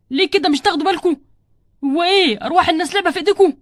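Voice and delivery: loud, outraged telling-off over the phone; indignant scolding. Acted. Husband scolds clinic over swapped scans, angry and loud. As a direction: lay into them, hitting words hard.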